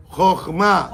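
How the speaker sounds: "Jochma" with the last A stressed; stress on final A. Shown as a demonstration. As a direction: lean on the closing A of "Jochma". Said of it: "con acento en la última A".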